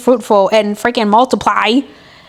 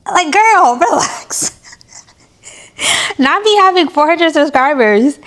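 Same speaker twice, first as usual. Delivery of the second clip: higher, laughing sass at herself; sassy self-scold; 4 semitones higher than usual. Pretend. Vlogger teasing herself about imposter syndrome, sassy. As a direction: tell yourself off with a laugh. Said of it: "scolding herself".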